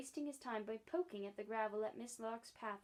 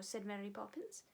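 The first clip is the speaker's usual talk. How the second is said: usual speaking voice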